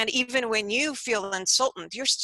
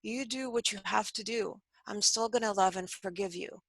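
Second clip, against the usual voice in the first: soft, steady, unhurried reply; very calm; 6 dB quieter than usual. Retold or imitated. Student answering the man with the knife, very calmly. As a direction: answer a threat quietly and with conviction. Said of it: "the student very calmly goes".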